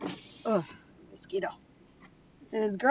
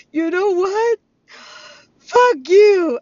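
high, loud crying curse; mock crying outburst; 10 semitones higher, 11 dB louder, much slower than usual. Ex-husband in tears cursing at her, mock crying. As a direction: wail it like a sobbing tantrum. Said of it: "I burst his little bubble in tears".

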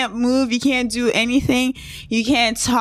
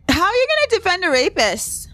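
higher, exasperated question; incredulous; 8 semitones higher than usual. Real, not acted. To listeners about the man's excuse, high incredulous. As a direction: throw the question back in disbelief.

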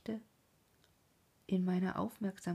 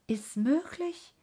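higher, louder, drawn-out disbelief; startled outcry; 6 semitones higher, 5 dB louder, slower than usual. A narrator's reading. Werther flares up at news of a killing, vehement outcry. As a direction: cry out slowly in vehement disbelief. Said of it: "fuhr er mit Heftigkeit auf".

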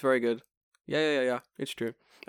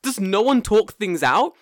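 exasperated; 10 semitones higher, 9 dB louder, slower than usual. Real, not acted. Exasperated rant.

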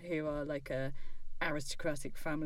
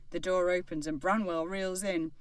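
ordinary delivery

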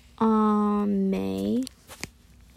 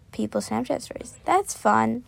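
ordinary delivery

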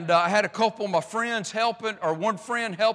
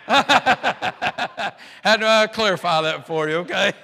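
real burst of laughter, louder; laughing; 5 dB louder than usual. Real, not acted. Preacher clarifying a name to the congregation, laughing. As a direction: talk through a real laugh.

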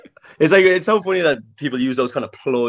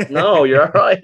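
dismissive brush-off; 8 semitones higher, 6 dB louder, slower than usual. Retold or imitated. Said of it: "I was like".

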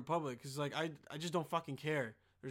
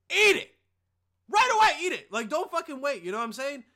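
loud, high yelled advice; angry shout; 8 semitones higher, 11 dB louder than usual. Real, not acted. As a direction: bark the advice at full volume. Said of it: "Sorry for getting angry like that".

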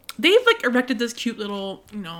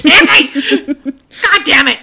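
exasperated outburst; 2 semitones higher, 11 dB louder than usual. Real, not acted. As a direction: groan out the villain's name. Exasperated at villain's return.

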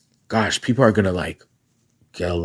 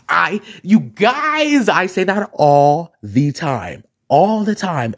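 excited; 6 semitones higher, slower than usual. Real, not acted. Excited self-validation.

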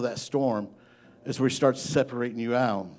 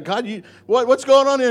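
higher-pitched retold plea to God; exasperated pleading; 12 semitones higher, 8 dB louder than usual. Preacher recalls questioning God in prayer, exasperated pleading. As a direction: act out a bewildered protest.

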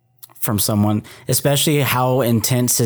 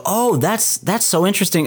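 mildly acted fascination, pitched up; higher-pitched, intrigued exclamation; intrigued reaction; 7 semitones higher than usual. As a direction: act out a little burst of interest. Voicing his own delighted reaction.

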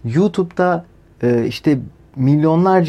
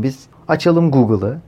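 ordinary delivery

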